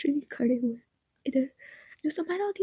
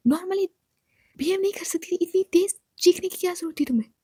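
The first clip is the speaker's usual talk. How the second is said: scolding; 4 semitones higher, 5 dB louder than usual. Acted. Scolding the girl for screaming.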